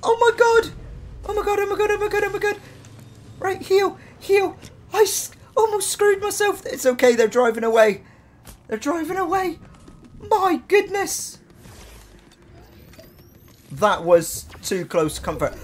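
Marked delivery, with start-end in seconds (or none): 0.0-6.6 high panicked shrieking. Real, not acted. Panic when near death. 6.6-11.4 high relieved squeal. Real, not acted. Relief after the panic.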